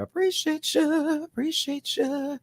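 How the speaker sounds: high, slow cooing thanks; drawn-out high put-on thank you. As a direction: coo the thanks sweetly up high.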